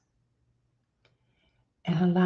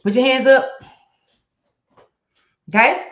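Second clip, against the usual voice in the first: high, loud call to raise hands; rally them into the exercise; loud command; 8 semitones higher, 7 dB louder than usual. Shown as a demonstration. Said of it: "Okay, here we go".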